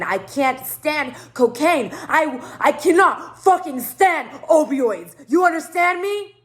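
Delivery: loud, slow, rising rant; heated, drawn-out declaration; vehement protest. Retold or imitated.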